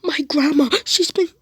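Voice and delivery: very high, loud shrieking panic, rising; panicked scream. Retold or imitated. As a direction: shriek in terror over grandma. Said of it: "she starts screaming in panic".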